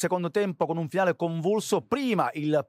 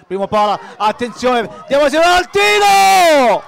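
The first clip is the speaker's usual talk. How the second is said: much higher and louder build to the shot; rising excited commentary; 10 semitones higher, 14 dB louder than usual. Acted. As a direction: shout the final chance. Last-minute attack call.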